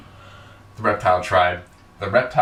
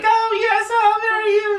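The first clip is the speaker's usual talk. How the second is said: very high, gushing squeal; hammed-up excitement; 4 dB louder than usual. Acting out Taya accepting the proposal, squealing with excitement. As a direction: squeal it like an overjoyed bride-to-be. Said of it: "And she's so excited".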